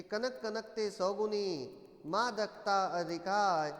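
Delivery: slow, measured couplet, strongly voiced; verse recitation. Shown as a demonstration. Teacher repeating the textbook example, slow verse recitation. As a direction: recite each phrase firmly with long syllables. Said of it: "बहुत सारे उदाहरण देखिए".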